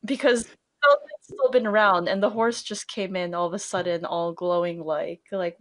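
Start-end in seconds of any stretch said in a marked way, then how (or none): none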